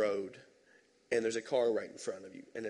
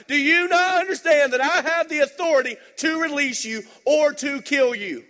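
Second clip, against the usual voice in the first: high, loud, furious scolding; angry Pilate; 13 semitones higher, 10 dB louder than usual. Retold or imitated. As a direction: bark at him like an angry boss. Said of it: "Pilate's like".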